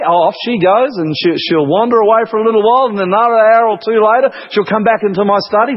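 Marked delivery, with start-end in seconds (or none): none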